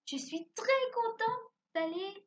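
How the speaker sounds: high, slow, delighted exclamation; explosive delight. Acted. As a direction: act out being thrilled, high-pitched and drawn out. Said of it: "exprimer ça dans un ton explosif".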